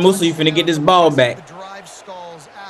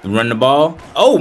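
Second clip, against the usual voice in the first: ordinary talk, nothing marked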